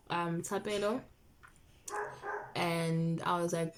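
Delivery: usual speaking voice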